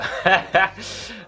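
a quick embarrassed laugh; short laugh. Real, not acted. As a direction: laugh it off lightly. Laughs at own accent. (petit rire gêné)

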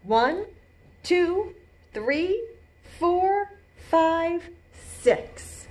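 slow, spaced count and storybook read; deliberate picture-book rhythm; reading to children. A narrator's reading. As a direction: set each word apart for little listeners.

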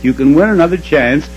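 usual speaking voice

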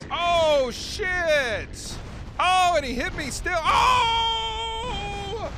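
high, loud startled cries; real alarmed shouting; shocked yelling. Real, not acted. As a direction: yelp as he gets run over. Getting hit by a car.

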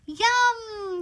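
ordinary delivery, nothing marked

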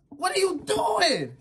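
bewildered high whine. Real, not acted. Shouting at mouse.

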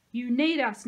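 loud, emphatic claim; narrated firm insistence; insistent. A narrator's reading. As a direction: insist on being included. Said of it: "Nico insisted".

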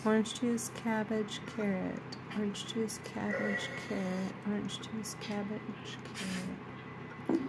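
soft repeated mutter to herself; muttering under breath. Real, not acted. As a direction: murmur the list quietly over and over.

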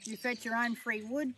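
usual speaking voice

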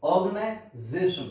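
normal talking voice

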